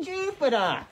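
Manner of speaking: high-pitched mock-British name call; amateur British impression; attempted British accent. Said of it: "that accent you're doing".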